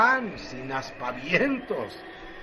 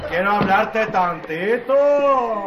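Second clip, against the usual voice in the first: raised, slow call to come closer; calling over; 5 semitones higher, 6 dB louder, slower than usual. Acted. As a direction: call him over, louder and higher. Man calls someone over.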